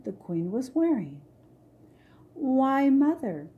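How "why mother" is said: higher, louder surprised exclamation; exclaiming. Acted. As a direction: burst out in surprise. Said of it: "exclaimed Aurora".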